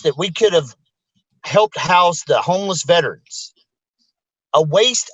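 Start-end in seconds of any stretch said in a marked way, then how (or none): none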